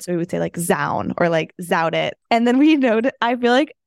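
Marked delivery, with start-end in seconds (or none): 0.6-1.1 "Zown" with a buzzing Z where the D goes. Shown as a demonstration. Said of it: "put Z at the beginning of, like, a D word". 1.6-2.1 "Zout it" with a buzzing Z replacing the D. Shown as a demonstration. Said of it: "put Z at the beginning of, like, a D word".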